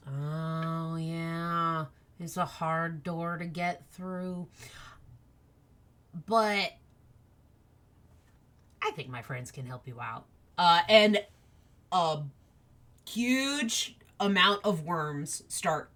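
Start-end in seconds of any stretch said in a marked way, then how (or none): 0.1-10.3 soft, slow creature voice; little worm. Acted. Worm offers Chess friends to help, soft slow voice. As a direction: take it slowly in a small soft voice. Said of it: "and the worm goes".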